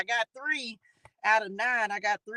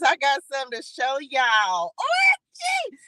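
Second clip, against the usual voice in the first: excited; 5 semitones higher than usual. Real, not acted. Host bursts out to viewers with exciting news, squealing. Excited announcement, shout heard.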